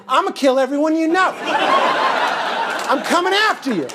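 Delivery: high, hammed-up menacing cry; mock threat. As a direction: comically flip into a murderous rant. Mock threat after unreturned smile.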